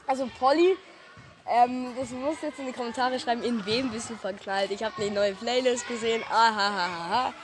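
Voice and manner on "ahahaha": teasing laugh. Acted.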